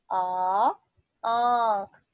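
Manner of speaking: loud, stretched "आ" before each word; loud recitation drill. Shown as a demonstration. Teacher leading a loud drill of "आ" words. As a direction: call out each word for children to repeat. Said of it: "मेरे साथ पढ़ें".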